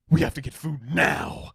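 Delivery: hammed-up hungry urgency; mock urgent demand. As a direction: demand it, exploding on the last word. Acted urgent demand for food.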